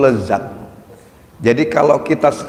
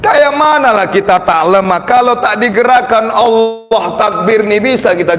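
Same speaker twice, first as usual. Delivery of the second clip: fervent preaching; 8 semitones higher than usual. Acted. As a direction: build the sermon to a climax. Preaching at full force.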